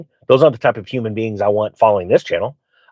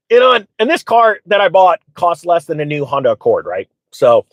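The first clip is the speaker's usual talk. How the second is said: ordinary talk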